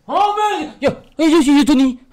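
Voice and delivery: high, loud fan outburst; excited calling out. Real, not acted. Fan spotting Tony Stark, excited shout. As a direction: call out to a hero, overexcited.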